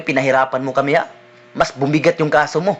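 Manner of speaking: a policeman berating the escaped prisoner; angry police officer. Retold or imitated. (pulis na galit, mas mababang boses)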